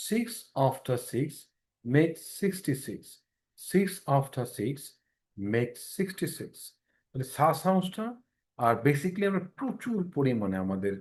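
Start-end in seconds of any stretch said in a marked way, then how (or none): 0.1-6.5 slowly, s on the tip of the tongue; slow careful drill. Shown as a demonstration. Teacher drills the s sound slowly for students. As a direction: make sixty-six drawn out slowly, s sounds at the front of the tongue. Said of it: "সাউন্ডগুলোকে ঠোঁটে নিয়ে আসবেন".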